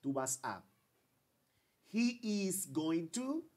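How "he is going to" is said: slow, "he" lifted high; slow long form. Shown as a demonstration. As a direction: model the full form slowly. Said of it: "Aquí yo digo".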